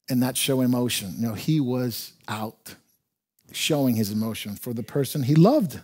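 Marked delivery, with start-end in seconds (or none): none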